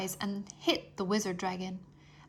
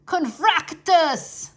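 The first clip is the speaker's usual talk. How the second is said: slow, booming spell cry, pitched up and loud; wizard dragon's cry; 8 semitones higher, 8 dB louder, much slower than usual. Acted. Wizard dragon Zoltan casting a blast at Drake, booming cry. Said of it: "Zoltan cried".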